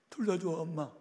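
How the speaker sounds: a schoolgirl begging her mother; pleading daughter. Retold or imitated.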